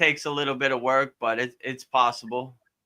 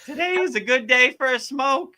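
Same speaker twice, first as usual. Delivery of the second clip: high-pitched little tempter; 12 semitones higher, 4 dB louder than usual. Acted. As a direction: lure them playfully in a tiny raised voice. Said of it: "it was like that little voice".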